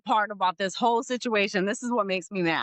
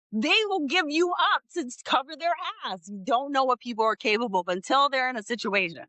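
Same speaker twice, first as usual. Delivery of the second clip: ordinary talk, nothing marked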